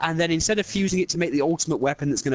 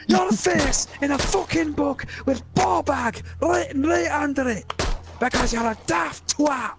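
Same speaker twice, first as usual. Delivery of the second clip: higher Scottish voice, mocking and scolding; Scottish rant; 10 semitones higher than usual. Scottish character mocking the ring bearer's selfishness, higher voice. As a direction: berate the selfish hobbit in a Scot's voice. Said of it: "first time I've used the accent".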